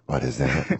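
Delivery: low, drowsy, confused mumble; just woken up, puzzled; groggy startled voice. Retold or imitated. Nico startled by phone on bunk.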